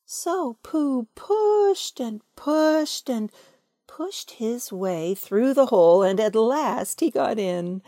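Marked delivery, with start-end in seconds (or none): none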